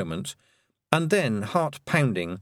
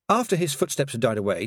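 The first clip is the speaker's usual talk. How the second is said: usual speaking voice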